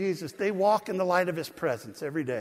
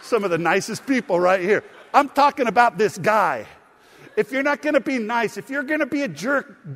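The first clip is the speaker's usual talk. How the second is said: louder, rising preacher's call; preaching at full force; 4 semitones higher, 6 dB louder than usual. Acted. Pastor to his congregation, preaching louder and higher. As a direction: press the point home to the church.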